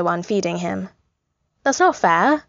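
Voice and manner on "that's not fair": higher, whiny complaint; a pitched-up mock sulk; complaining. A narrator's reading. Tony sulking that baby Steve won't eat for him, whiny. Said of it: "Tony complains".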